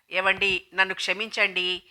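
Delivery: ordinary delivery, nothing marked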